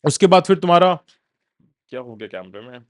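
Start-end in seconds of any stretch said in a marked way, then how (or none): 1.9-2.8 much softer, lower off-hand aside; quiet aside. Real, not acted. Lecturer checking the camera mid-recording, quiet aside. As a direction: drop right down, as if talking away from the mic.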